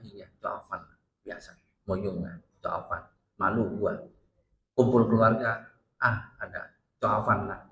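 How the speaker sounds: lightly voiced mother grumbling about family shame; scolding Chinese mother. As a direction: mutter it like a disappointed mother.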